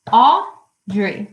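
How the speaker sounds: AUDREY broken in two, Au... drey; syllable by syllable. Shown as a demonstration. Teacher showing the class two syllables, syllable by syllable. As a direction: say Audrey as two separate beats. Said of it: "has two syllables".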